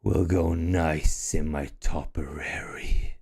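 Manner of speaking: low, slow, whispered dragon threat; menacing dragon whisper. Acted. Dragon gloating to the party about its collection, whispered low.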